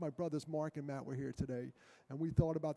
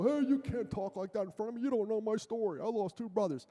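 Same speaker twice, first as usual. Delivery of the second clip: mock woke scolding; 5 semitones higher than usual. Speaker mocking how he won't scold a joking kid, indignant. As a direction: play the offended preacher calling them out. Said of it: "get all woke on 'em".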